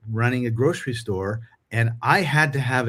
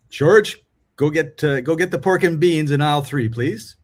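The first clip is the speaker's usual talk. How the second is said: calling out to clerk; 4 semitones higher, 5 dB louder than usual. Retold or imitated.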